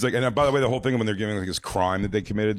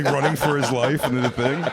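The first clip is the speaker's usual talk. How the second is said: normal talking voice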